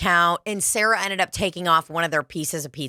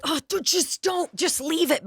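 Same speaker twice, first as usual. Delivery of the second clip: pleading coworker; 10 semitones higher than usual. Retold or imitated. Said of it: "she basically was like".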